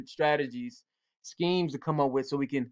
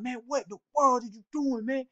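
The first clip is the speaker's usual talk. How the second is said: high-pitched coach rant at no extra volume; mock scolding, pitched way up; mock angry yell; 7 semitones higher than usual. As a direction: imitate an exasperated coach yelling, held back in level. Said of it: "screaming, yelling".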